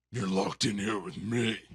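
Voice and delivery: slow, loud vigilante threat; Rorschach. Acted. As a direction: turn the threat round with full force. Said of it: "And he's like".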